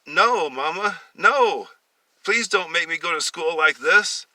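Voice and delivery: put-on high, loud begging boy's voice; pleading child. Boy begging his mother not to make him go in a dress.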